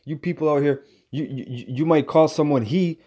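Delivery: ordinary delivery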